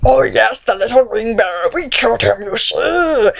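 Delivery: creepy, gloating monster voice; put-on villain gloat; Mouth of Sauron. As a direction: act a gross creature taunting the enemy. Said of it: "so he's like".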